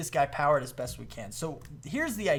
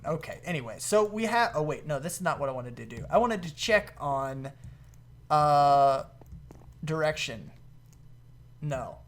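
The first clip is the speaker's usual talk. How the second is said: ordinary talk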